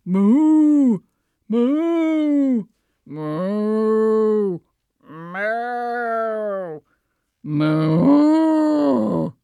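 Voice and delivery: long cow moos. Acted. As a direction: moo like a cow. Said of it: "adding a few moos".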